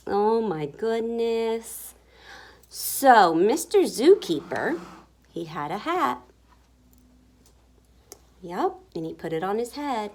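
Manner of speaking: ordinary delivery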